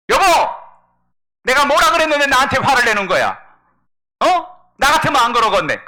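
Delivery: loud, high-pitched acted outburst; angry wife. Wife confronting her husband for getting angry, indignant shout. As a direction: shout back indignantly at full volume.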